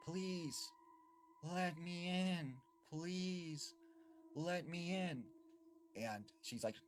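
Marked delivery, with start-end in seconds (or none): 0.1-5.2 put-on high, drawn-out child's plea; eerie pleading boy. Strange boy at the front door begging entry, eerie pleading. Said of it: "she said this boy responded".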